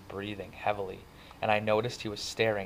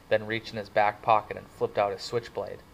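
ordinary delivery

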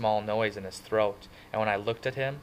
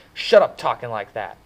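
much louder, fierce and forceful; 11 dB louder than usual. Acted. Two-Bit fiercely cuts off Johnny's self-pity. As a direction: shut it down hard. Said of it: "Two-Bit said fiercely".

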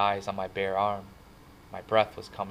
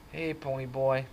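sleepily; 5 semitones higher than usual. A narrator's reading. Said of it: "he said sleepily".

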